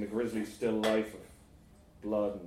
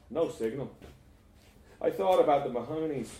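ordinary delivery

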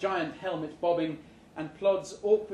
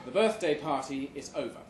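ordinary delivery

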